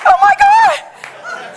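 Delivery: high, loud, drawn-out horrified cry; mock panic. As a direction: overplay the dawning horror, stretched out and high. Panics that camel is blind.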